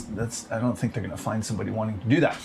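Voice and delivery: usual speaking voice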